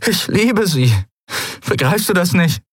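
high-pitched, urgent protest; desperate. A narrator's reading. Kai confessing his love to the spirit, desperate. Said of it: "Er fuhr sich verzweifelt durch das Haar".

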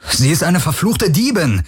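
high, heated accusation; indignant outburst; snorting anger. A narrator's reading. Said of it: "schnaubte Garland".

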